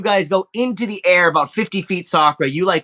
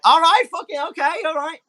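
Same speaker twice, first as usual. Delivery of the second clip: high, hammed-up nervous NPC voice; Hermes bracing himself; 13 semitones higher than usual. Hermes pushed toward the slide, high nervous mock bravado. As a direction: psych yourself up in a high put-on voice. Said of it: "He's like".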